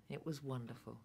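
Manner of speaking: wistful little laugh, then softly. Acted. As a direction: laugh gently through the memory. Tender memory with laugh.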